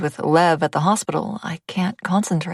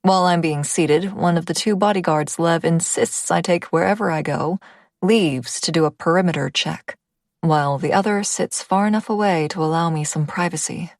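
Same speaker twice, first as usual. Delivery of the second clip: ordinary delivery, nothing marked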